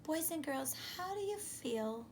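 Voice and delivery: gentle classroom question; soft teacher aside. Acted. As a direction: lean in and ask the kids quietly.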